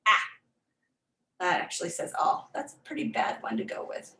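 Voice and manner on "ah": short vowel ah, as in "ah"; short a sound. Shown as a demonstration. Teacher modelling the short vowel ah. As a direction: say "ah" as the short a in a closed syllable. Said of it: "it has to say its short sound".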